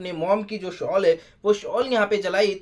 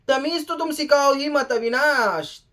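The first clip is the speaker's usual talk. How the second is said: indignant retort; 6 semitones higher than usual. Retold or imitated. Voices mother's angry retort.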